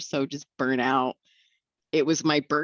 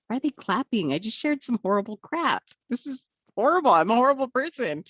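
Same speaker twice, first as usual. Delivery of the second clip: bewildered protest; 9 semitones higher than usual. Retold or imitated. Acting out her bewilderment at applause.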